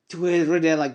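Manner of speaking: ordinary delivery, nothing marked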